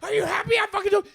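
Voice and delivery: very high, loud retold outburst; exasperated shout. Snapping at friends after the forced confession, shrieking in exasperation. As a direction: act out cracking under pressure.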